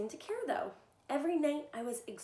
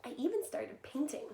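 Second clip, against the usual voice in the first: ordinary delivery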